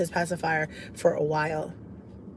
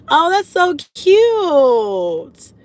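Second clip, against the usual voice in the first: gushing; 8 semitones higher, 7 dB louder, much slower than usual. Real, not acted. Reacting to sweet comment.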